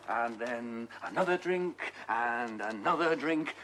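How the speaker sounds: drawn-out, slow and mocking; a detective slowly goading the suspect; slow taunting. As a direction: stretch each "another drink" slowly. Detective spins a taunting theory.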